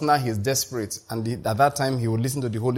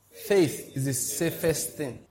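very slow, softer "Faith is the safest thing" for joining in; say-along; 2 semitones higher, 3 dB quieter, slower than usual. Shown as a demonstration. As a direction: lead listeners word by word through "Faith is the safest thing". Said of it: "Please say it with me".

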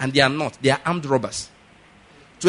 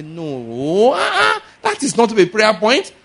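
high, slow, incredulous 'no, ah'; mock outrage at a silly prayer point; scornful refusal; 7 semitones higher, 5 dB louder, much slower than usual. Pastor scoffing at the man's prayer point, incredulous and high.

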